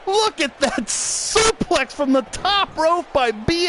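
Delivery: screaming hype call, high and loud; wrestling announcer losing it; wrestling commentator. Acted. Hyped commentary on a big move.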